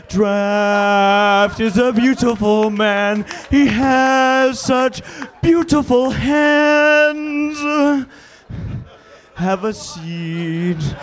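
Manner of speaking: slow, drawn-out reading over music; dramatic reading. Acted. Panelist reading a mock romantic poem slowly over music. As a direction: savor every word like a crooner.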